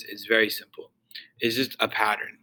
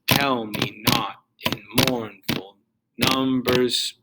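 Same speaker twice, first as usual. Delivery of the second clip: slow beat on "Tell", "not", "mourn", "num"; trochaic stress; much slower than usual. Shown as a demonstration. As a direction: stress the first of each two syllables in "Tell me not in mournful numbers". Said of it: "Trochaic, we would have".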